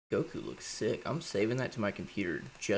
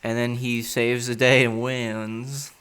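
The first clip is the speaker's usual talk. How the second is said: louder, slow, big finish; loud triumphant narration; 3 semitones higher, 10 dB louder, slower than usual. Real, not acted. Wrapping up the Goku story, loud and slow. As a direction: announce the victory like a trailer.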